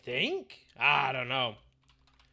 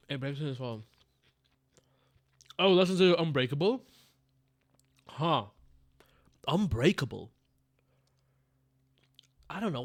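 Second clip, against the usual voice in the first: ordinary delivery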